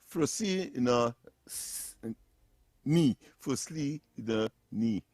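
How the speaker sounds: slow, syllable by syllable; slow careful syllables. Shown as a demonstration. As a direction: sound out Flosina ni slowly. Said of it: "slow careful syllables".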